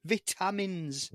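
"vitamins" slowly as VIT-A-MINS; British "vitamins", corrective. Shown as a demonstration. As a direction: spell out the sound of "vitamins", short I, clear T. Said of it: "It's a T".

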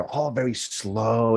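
very slow, stretched words; slow-motion storytelling; drawn-out. Retold or imitated. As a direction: draw it out to mime a slow move. Acting out the slow purse movement.

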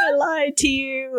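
high, slow "I lied to you"; giddy confession. Retold or imitated. Admitting the trick to Shane, high slow giddy. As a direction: confess it gleefully.

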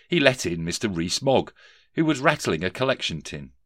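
ordinary delivery, nothing marked

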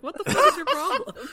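high, quick mock indignation through laughter; retold outrage. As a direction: rattle off the coworker's outburst, laughing. Quotes coworker's outrage, laughing.

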